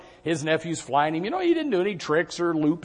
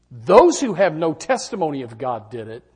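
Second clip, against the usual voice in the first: usual speaking voice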